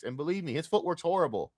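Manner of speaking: usual speaking voice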